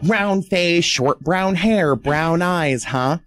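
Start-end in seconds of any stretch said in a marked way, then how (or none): none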